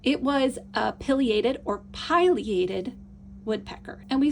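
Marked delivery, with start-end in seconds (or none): 0.9-1.6 pileated with a short i, "PILL-ee-ay-tid". Shown as a demonstration. Teacher weighing two ways to say a bird's name, short-i pileated. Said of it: "which is the correct way to say this". 1.9-2.9 pileated with a long i, "PIE-lee-ay-tid". Shown as a demonstration. Teacher weighing two ways to say a bird's name, long-i pileated. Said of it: "which is the correct way to say this".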